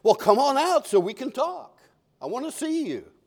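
high, lilting call to someone hiding; lifted pitch, inviting; God coaxing. Acted. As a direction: coax them out of hiding. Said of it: "The third time".

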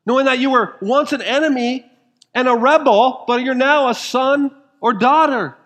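high, loud preacher's cry; fervent pulpit climax; preaching at full force. Acted. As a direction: lift the congregation with a ringing proclamation. Preaching climax on past darkness.

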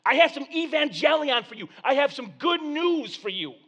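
high, loud announcing voice; herald announcing. Acted. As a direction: proclaim it to the crowd. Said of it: "You're saying".

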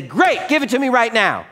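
high, loud, laughing mock demand; greedy demand. Christians demanding God's blessing now, high laughing mock greed. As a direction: play the impatient believer grabbing the gift. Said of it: "And then we'll say".

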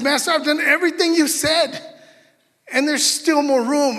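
high, loud excited report; eager servant. Acted. Servant reporting back to his master, high and eager.

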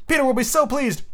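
high, gleeful fairy squeal; mock fairy scream; Tinkerbell screaming. A narrator's reading. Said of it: "she screamed".

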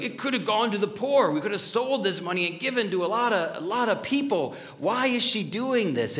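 mock the outraged onlookers grumbling about the waste; indignant critics. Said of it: "Because they're like".

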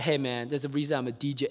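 a lightly voiced know-it-all DJ; smug DJ. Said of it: "And this guy's like".

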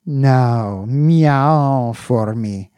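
very slow, high coaxing. Acted. Owner coaxing his cat to meow, lip-licking. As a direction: drag it out, coaxing. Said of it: "said Slopes, licking his lips".

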